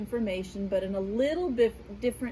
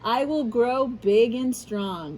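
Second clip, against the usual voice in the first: put-on reading to children; 2 semitones higher, 4 dB louder than usual. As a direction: unveil the answer with storybook drama. Reads the answer page.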